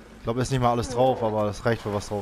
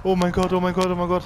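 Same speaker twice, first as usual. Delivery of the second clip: panicky; 6 semitones higher than usual. Real, not acted. Mammut hinter ihm.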